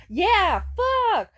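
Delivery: sudden high, loud yell; real exasperated shout; exasperated outburst. Real, not acted. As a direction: blurt it out as it clicks. Outburst at realizing the racism.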